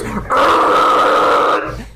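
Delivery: voiceless vuvuzela drone; vuvuzela imitation. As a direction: blow it like a stadium horn. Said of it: "It sounds like that".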